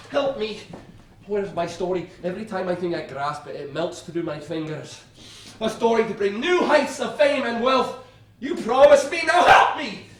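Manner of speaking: high desperate pleading shout; desperate plea. Real, not acted. Pleading to his muse.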